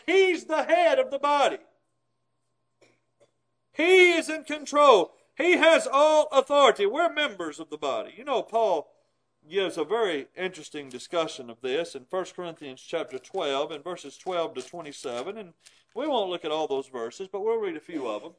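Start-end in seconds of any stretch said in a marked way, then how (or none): none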